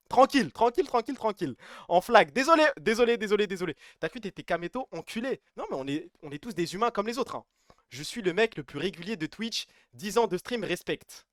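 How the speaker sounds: ordinary delivery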